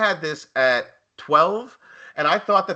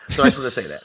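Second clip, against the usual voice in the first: ordinary talk, nothing marked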